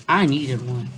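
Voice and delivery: usual speaking voice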